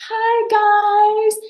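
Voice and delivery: high, bright sing-song hi guys; mock the sweet polished greeting she refuses to use. Shown as a demonstration. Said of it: "And I talk just like this".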